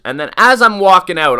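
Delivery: normal talking voice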